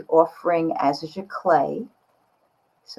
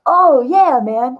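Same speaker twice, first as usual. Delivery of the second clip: a much higher, louder, delighted "oh yeah, man"; excited oh yeah; 7 semitones higher, 8 dB louder, much slower than usual. Retold or imitated. To the friend, recalling her reaction to the post, excited. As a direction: burst out with glee like it just clicked. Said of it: "I was like".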